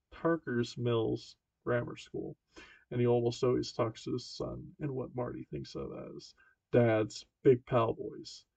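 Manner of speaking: ordinary delivery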